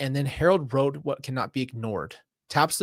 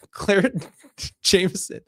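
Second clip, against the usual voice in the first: laughing; 6 semitones higher, 5 dB louder than usual. Real, not acted. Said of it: "Rubby prompt two. Read".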